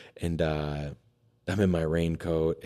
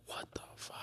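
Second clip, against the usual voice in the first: stunned whisper; 17 dB quieter than usual. Retold or imitated. Stunned at dinner prepared.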